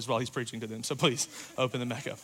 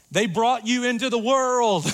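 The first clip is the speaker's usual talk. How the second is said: higher, louder, pressing it home; emphatic punchline; 7 semitones higher, 5 dB louder than usual. Real, not acted. Preacher to congregation, louder punchline. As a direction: hammer the point home. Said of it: "preacher's punchline".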